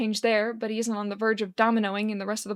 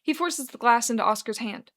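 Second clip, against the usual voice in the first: ordinary delivery, nothing marked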